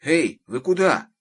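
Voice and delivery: high, loud questioning call; bewildered shout. A narrator's reading. Senya asking fleeing children where they go, bewildered. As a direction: shout after them, at a loss. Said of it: "крикнул, растерянно озираясь, Сеня".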